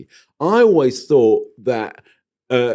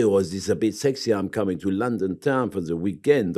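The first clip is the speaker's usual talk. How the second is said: imitated French-accented English, softer and lower; 5 semitones lower, 6 dB quieter than usual. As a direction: put on a seductive French accent. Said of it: "a French accent speaking English".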